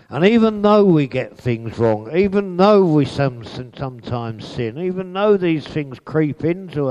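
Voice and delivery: loud, high build of even though; rising loud pulpit build; preaching. Acted. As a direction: drive each even though harder.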